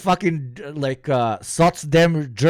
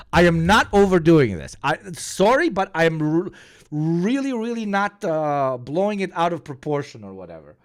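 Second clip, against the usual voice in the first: ordinary delivery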